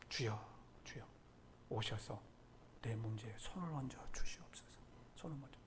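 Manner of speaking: low, soft plea to God; hushed pleading prayer. Shown as a demonstration. Preacher shows congregation a desperate prayer, hushed and pleading. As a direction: plead quietly, almost under the breath. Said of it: "이런 절박함을 가지고, 절박함을 가지고 기도하는".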